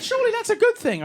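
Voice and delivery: high-pitched, innocent mock-wife voice; naive wife. Wife thinks looking posh is good, high naive voice. Mimics wife's naive reply.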